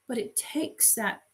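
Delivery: ordinary talk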